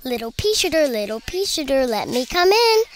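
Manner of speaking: ordinary delivery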